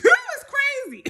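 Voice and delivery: high, slow words through laughter; laughing. Real, not acted. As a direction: laugh at how absurd it was. Laughing at the absurdity.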